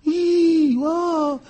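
high delighted gasps of wonder; awed tourists. Retold or imitated. Mimicking tourists in Patagonia gasping at the view, high and delighted. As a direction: gasp in awe at the scenery. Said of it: "toda la gente".

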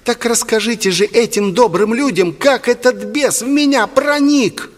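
put-on high, overwrought falsetto boy; defiant boy. A narrator's reading. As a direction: challenge the priest in a high voice. Boy defiantly challenges the priest.

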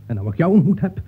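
ordinary delivery

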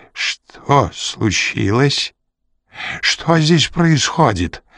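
slow, strained croak, rising high; hoarse. A narrator's reading. Старик faced with a pistol, hoarsely. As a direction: force it out in shock. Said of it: "хрипло выдавил он".

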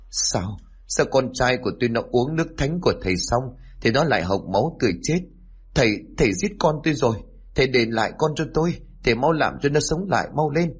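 high, fast, frantic pleading accusation; a mother screaming at the healer for her dead son; distraught accusing cry. Acted. Mother accusing the healer of killing her son, frantic high cry.

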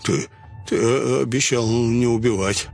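wet gurgling, frightened stammer. Acted. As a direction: gurgle the words, scared. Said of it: "проговорил булькающим голосом".

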